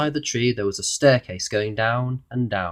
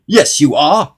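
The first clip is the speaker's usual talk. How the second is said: loud, higher, irritated snap; snapping; 5 semitones higher, 11 dB louder, slower than usual. A narrator's reading. Mister Uppity arguing with the goblin, loud snap. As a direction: snap back hard. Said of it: "snapped Mister Uppity".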